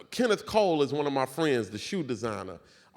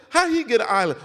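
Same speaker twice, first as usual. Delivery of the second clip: incredulous; 9 semitones higher, 9 dB louder, faster than usual. Retold or imitated. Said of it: "I said".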